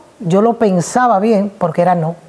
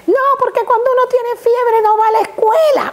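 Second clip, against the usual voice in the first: put-on high child voice arguing; a small girl making her case; clever little girl; 12 semitones higher than usual. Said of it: "daughter argues".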